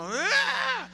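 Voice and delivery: high hammed-up battle cry of an exorcist; a sudden, theatrical "¡Ah!" from the preacher; mock exorcist cry. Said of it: "La pose del liberador".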